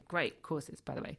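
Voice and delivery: ordinary talk, nothing marked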